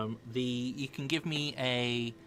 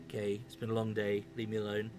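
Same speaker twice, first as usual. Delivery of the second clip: ordinary talk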